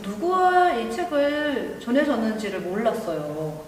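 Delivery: ordinary delivery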